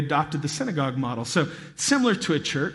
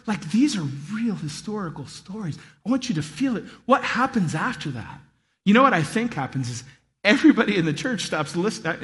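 ordinary delivery

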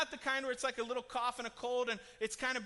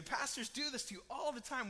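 ordinary talk, nothing marked

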